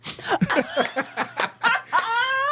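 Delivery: high burst of laughter; big laugh. Real, not acted. Laughs at punchline.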